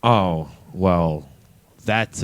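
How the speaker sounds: usual speaking voice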